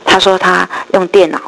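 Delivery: ordinary delivery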